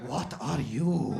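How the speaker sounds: imitated shopkeeper's question. Doner guy quizzes customer on origins, slow and probing. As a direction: stretch it out slowly in the shopkeeper's voice. Shopkeeper asks, then asks bigger.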